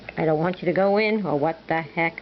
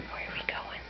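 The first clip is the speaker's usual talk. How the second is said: whisper; 15 dB quieter, faster than usual. Acted. Whispering to bird.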